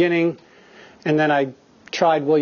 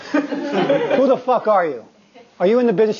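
laughing disbelief; 6 semitones higher than usual. Retold or imitated. Actor asking the stranger who she is, laughing. Actor surprised by fan's knowledge.

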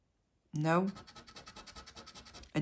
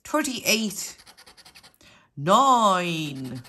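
usual speaking voice

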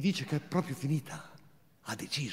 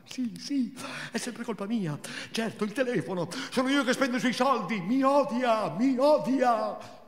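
higher, bitter, self-pitying rant; Marina's thin voice; 8 semitones higher than usual. Acted. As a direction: whine it out high and thin. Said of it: "quella sua voce sottile e inadeguata". (voce sottile, amareggiata)

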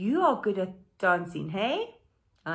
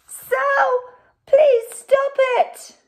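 high, loud group shout; the band members roar at Sal, high and loud; 13 dB louder, much slower than usual. Acted. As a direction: yell it at Sal to stop. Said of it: "The others all roar".

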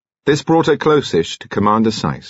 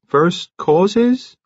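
higher, rising questioning repeat; dimly puzzled echo; 11 semitones higher than usual. A narrator's reading. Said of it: "Oliphant repeated dimly".

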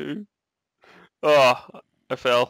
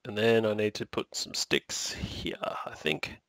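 whispered under the breath; whispering; 3 semitones lower, 9 dB quieter, slower than usual. Real, not acted. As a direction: whisper it to yourself. Muttering to himself while crafting.

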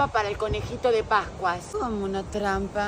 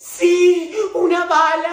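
put-on loud high kid voice; 9 semitones higher, 11 dB louder, slower than usual. Kid insisting loudly.

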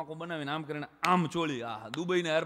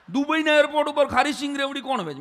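excited; 10 semitones higher, 12 dB louder than usual. Real, not acted. Excited disbelief, much louder.

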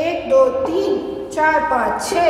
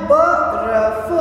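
बर्फ stretched out very slowly; slow, careful बर्फ; बर्फ blended slowly; slow blending; 4 semitones lower, much slower than usual. Shown as a demonstration. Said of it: "अपन को क्या बोलना है".

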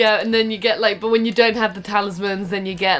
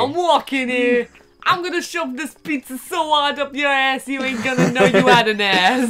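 hammed-up New York threat; New York accent; 4 semitones higher than usual. New Yorker robot threatening with pizza, mock New York accent. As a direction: rant like a cartoon New Yorker. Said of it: "All I can say in a New York".

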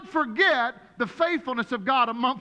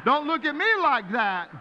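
higher, louder playful telling-off; mock scolding; 5 semitones higher, 4 dB louder than usual. Shown as a demonstration. Pastor jokingly scolding the congregation, higher and louder. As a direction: wag a finger at the crowd.

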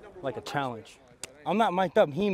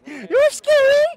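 high, slow, teasing squeal; mock scared; 10 dB louder, much slower than usual. Real, not acted. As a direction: squeal it like you're playfully spooked. Teasing a teammate.

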